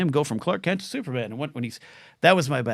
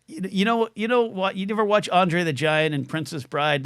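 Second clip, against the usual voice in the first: ordinary delivery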